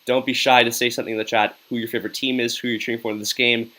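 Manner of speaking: ordinary delivery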